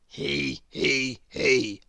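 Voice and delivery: low, glum forced titter; mournful he he he. Acted. Grizzle repeating the laugh, mournful joyless he he. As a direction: say the laugh with a sinking heart. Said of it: "repeated Grizzle mournfully".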